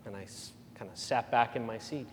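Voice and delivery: ordinary talk, nothing marked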